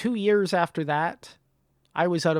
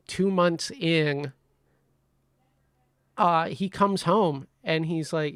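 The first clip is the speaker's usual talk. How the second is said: ordinary talk, nothing marked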